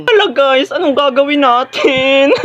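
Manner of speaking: high, drawn-out helpless wail; panicked. Acted. Student asking classmates what to do, panicked. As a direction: stretch the question into a wail.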